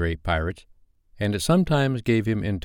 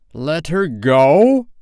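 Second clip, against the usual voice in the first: slow, loud, rising outrage; incredulous cry; 8 semitones higher, 7 dB louder, much slower than usual. A narrator's reading. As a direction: stretch it out in disbelief, climbing loud. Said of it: "cried Hook".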